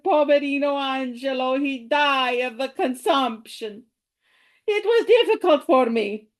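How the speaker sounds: put-on Italian immigrant accent, deliberate and measured. Great-grandmother telling of leaving Italy for America, Italian accent. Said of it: "going to do it in the accent".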